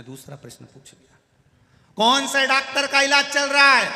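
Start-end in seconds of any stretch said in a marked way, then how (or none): 2.0-3.9 high, loud question; deaf man shouting. Retold or imitated. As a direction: shout to someone hard of hearing.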